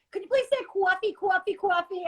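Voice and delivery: high, quick imitation of people asking; high-pitched playful mimicry; imitating eager requesters. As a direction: mimic a pestering request for the accented word. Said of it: "I got asked a few times".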